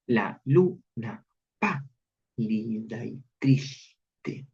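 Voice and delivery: "La luna pálida y triste" very slow, each first syllable leaned on; slow expressive recitation. Shown as a demonstration. Teacher reciting the verse to students, very slow. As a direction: let the words sink, very slowly. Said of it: "resalta los estados de ánimo".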